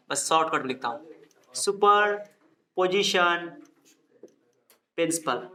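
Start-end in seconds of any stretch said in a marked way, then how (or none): 1.5-5.5 drawn out very slowly, word by word; slow dictation. Real, not acted. Teacher writing the term for the class, slow dictation. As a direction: say it slowly as you write it out.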